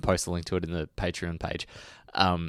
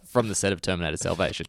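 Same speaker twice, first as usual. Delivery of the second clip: normal talking voice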